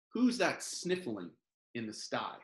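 usual speaking voice

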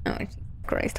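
breathless whispered fright; scared horror game streamer. Real, not acted. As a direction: whisper it in shock.

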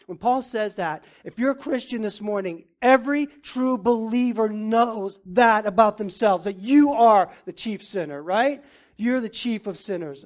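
loud, high, driving sermon delivery; fiery preaching. Real, not acted. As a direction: preach it hard to the congregation. Preaching at full force.

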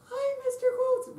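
very high, slow squeaky talking; squeaky helium voice; helium singer. Retold or imitated. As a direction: talk like you inhaled helium. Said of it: "the helium singer".

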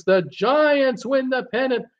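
high excited play-by-play shout; a mock announcer crying out the pennant; excited radio call. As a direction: call it like the famous broadcast. Famous radio home-run call quoted.

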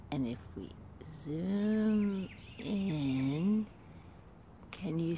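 soft secret whisper. Acted. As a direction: hush as if not to scare the squirrel. Whispers while zooming in.

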